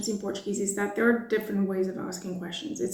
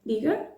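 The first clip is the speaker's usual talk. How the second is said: "Diga" with a high rising question tune; asking someone to repeat with a rising "Diga"; questioning imperative; 4 semitones higher than usual. Shown as a demonstration. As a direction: turn "Diga" into a question by lifting it. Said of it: "imperative with the intonation of a question".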